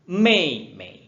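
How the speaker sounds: "Mei" high and loud, second "mei" light and toneless; first "Mei" high, then drop the tone; tone demo. Shown as a demonstration. Teacher drilling "Mei, mei" for pupils. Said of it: "Dan bawah dia".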